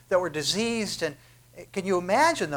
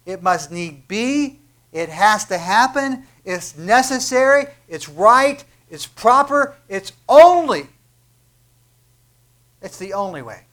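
building preaching; 3 semitones higher, 9 dB louder than usual. Real, not acted. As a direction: climb to a shout phrase by phrase. Building list in sermon.

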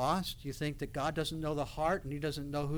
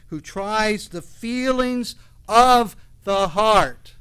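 fiery preaching; 5 semitones higher, 11 dB louder than usual. Acted. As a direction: thunder God's warning at the congregation. Preaching at full force.

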